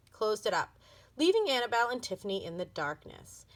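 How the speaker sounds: ordinary delivery, nothing marked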